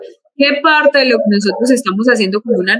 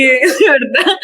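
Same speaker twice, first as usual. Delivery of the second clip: laughing through the words; real embarrassed laughter; 3 semitones higher, much slower than usual. Real, not acted. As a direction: giggle while admitting it. Laughing at her own confession.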